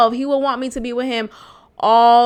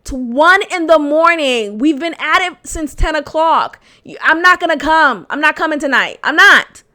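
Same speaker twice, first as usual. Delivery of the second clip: higher, louder exasperated telling-off; exasperated rant; 4 semitones higher, 6 dB louder than usual. Retold or imitated. Girlfriend telling boyfriend she must go, fed up. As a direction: lay it out loud and fed up. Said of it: "paint the pictures to him".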